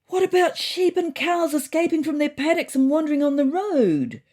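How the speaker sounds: high, loud indignant call from the crowd; loud cry. A narrator's reading. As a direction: shout the complaint across the room. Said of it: "I heard louder cries of".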